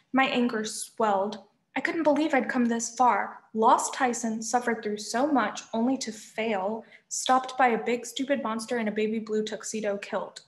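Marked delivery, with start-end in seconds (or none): none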